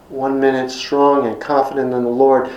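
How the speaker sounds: ordinary talk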